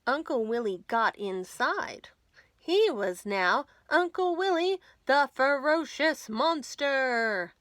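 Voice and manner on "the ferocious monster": ordinary delivery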